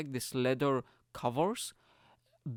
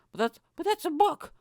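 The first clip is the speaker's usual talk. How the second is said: much higher and louder, incredulous; baffled protest; 5 dB louder than usual. Retold or imitated. Telling the waitress the menu is a book, incredulous. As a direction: squeak it out like you can't believe it.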